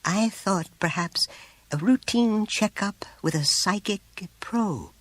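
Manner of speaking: high, slow, careful suggestion; delicate. A narrator's reading. Teacher suggesting a probe to the mother, delicate. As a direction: tread gingerly, leaving it unfinished. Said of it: "with every attempt at delicacy".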